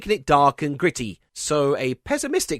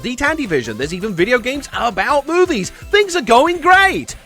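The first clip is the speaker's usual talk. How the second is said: excited, louder, high-swinging sales-pitch rattle; hyped enthusiasm; 6 semitones higher, 4 dB louder than usual. Acted. Narrator hyping up the console boom to viewers, mounting excitement.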